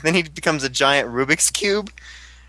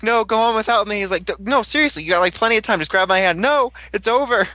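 higher, hammed-up back-and-forth pleading; mocking dramatic refusal; 7 semitones higher than usual. Fan mocking the hero refusing to take the hand. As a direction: overplay the noble sacrifice for laughs. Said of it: "kinda funny that he's like".

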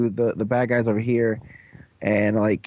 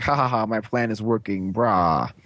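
a hint of a scheming villain; 2 semitones lower than usual. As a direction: gloat like a cartoon baddie. Villain giggling at his plan.

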